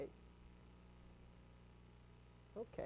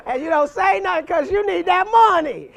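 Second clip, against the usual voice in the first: laughing; 8 semitones higher, 10 dB louder than usual. Real, not acted. Laughing at pawnshop story.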